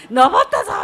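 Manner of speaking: loud proud cry; triumphant shout. Retold or imitated. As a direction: shout it from the summit. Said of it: "まるで別人のように". (登頂した生徒の大きな勝ちどき)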